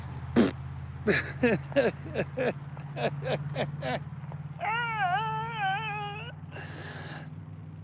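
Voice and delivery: high breathy laughing fit; long high wheezing laugh. Real, not acted. Man cracking up over the kitten name. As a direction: laugh till you can't stop.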